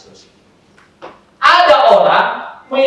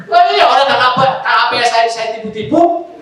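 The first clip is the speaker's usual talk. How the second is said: ordinary delivery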